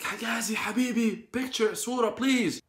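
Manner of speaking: high, eager calling out to a star; excited fanboy. Retold or imitated. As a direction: beg for a picture like a fan. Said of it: "obviously I'm like a fanboy".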